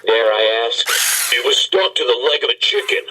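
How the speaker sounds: ordinary talk, nothing marked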